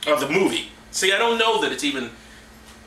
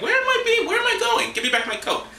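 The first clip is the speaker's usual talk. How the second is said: mock panicked Joseph in a much higher voice; whiny Joseph; 13 semitones higher than usual. Sold brother calls out to his brothers, mock panicked and much higher. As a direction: squeak it like a lost kid calling out. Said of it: "He's like".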